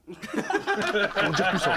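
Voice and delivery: words broken up by laughter; laughing. Real, not acted. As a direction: laugh through the end of the line. Laughing about a rapper's skill.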